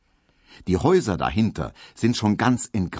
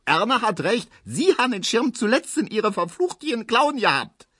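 high, screeching sarcastic retort; shrieking woman. A narrator's reading. Frau Ziebland shrieking at grandmother over the umbrella. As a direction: shriek back at the old woman. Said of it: "kreischt Frau Ziebland".

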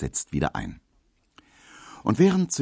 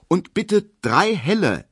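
firm; 13 semitones higher, 5 dB louder than usual. A narrator's reading. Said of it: "sagt Emil Kubinke bestimmt".

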